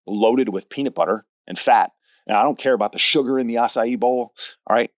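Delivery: ordinary talk, nothing marked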